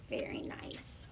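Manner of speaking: soft breathy whisper of praise. Real, not acted. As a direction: whisper it like a little secret compliment. Teacher's quiet aside to class.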